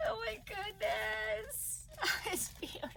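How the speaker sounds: overwhelmed, voice rising high then giving way; close to happy tears. Real, not acted. Vlogger seeing a gift, moved almost to tears. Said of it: "I'm gonna cry".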